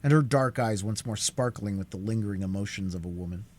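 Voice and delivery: plain reading voice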